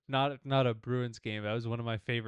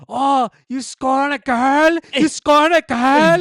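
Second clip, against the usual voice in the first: high, drawn-out full-force yell; screaming heckler; 14 semitones higher, 17 dB louder than usual. Retold or imitated. Fan heckling a player guarded by a girl, screaming. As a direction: holler it across the court in a thick accent. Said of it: "he's, like, screaming at the top".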